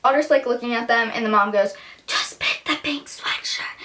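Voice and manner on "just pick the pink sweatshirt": slow, high, forceful whisper; imitated stage whisper. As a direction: hiss it so the bystander overhears. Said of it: "loud enough that I could hear it".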